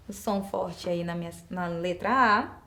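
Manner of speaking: ordinary talk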